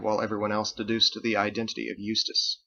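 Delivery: plain reading voice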